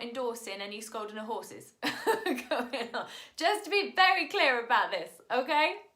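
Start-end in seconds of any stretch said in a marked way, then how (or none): 1.8-3.1 loud, breaking into laughter; joking disclaimer that dissolves into a laugh. Real, not acted. Vegetarian teacher disowning horse-scolding to audience, laughing loudly. 3.4-5.8 high, very loud mock-serious insistence; loud emphatic. Real, not acted. Teacher making a joking disclaimer very clear, loud and emphatic.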